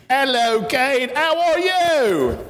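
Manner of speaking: a light mock British accent, high; playful posh British greeting in a British accent. Children's pastor greeting Kate in a mock British accent. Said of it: "That's my British".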